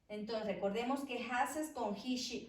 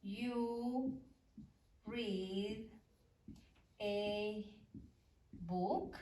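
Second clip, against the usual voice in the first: each word spaced out while writing; dictating an English question word by word to students; much slower than usual. Shown as a demonstration. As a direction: set down one word at a time for the board.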